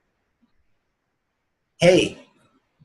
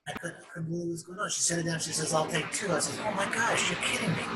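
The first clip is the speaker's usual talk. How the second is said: hushed amazement; 2 semitones higher, 11 dB quieter, faster than usual. Retold or imitated. Quiet retelling of her amazement.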